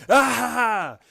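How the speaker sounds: high, loud imitated yell "Ah!"; mock yell. Retelling MJF's victory yell after the contract signing. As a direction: let out a hammed-up excited yell. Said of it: "you hear M J F yelling".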